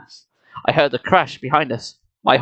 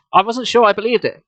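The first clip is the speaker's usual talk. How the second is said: usual speaking voice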